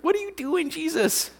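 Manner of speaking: very high, frantic pleading; hammed-up alarm in a squeaky high voice; mock panic. Disciples confronting sleeping Jesus in the storm, mock panic. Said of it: "the disciples are freaking out".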